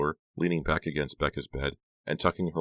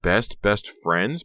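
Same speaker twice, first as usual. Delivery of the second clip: slow, higher, questioning "best friends"; incredulous echo; 5 semitones higher, 5 dB louder, much slower than usual. A narrator's reading. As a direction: repeat "best friends" in disbelief. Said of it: "Becca thought".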